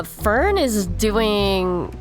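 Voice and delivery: normal talking voice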